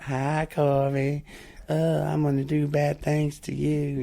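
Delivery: a lightly voiced creepy caller, slow on her greeting; creepy lady caller. Weather lady leaving a voicemail, in a slow creepy voice. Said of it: "She'd always be like".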